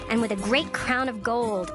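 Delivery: usual speaking voice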